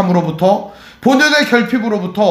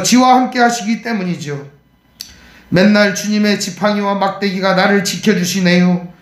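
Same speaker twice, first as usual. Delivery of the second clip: lightly voiced Chungcheong country dialect, folksy. 목사가 교인들에게 시편을 충청도 사투리로 읽어주며, 구수한 충청도 사투리. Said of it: "제가 충청도 천안 버전으로 한번 읽어드리도록 하겠습니다".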